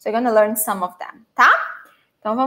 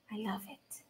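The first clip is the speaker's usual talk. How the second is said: whisper; 3 semitones lower, 24 dB quieter than usual. Real, not acted. Savouring strawberry milk.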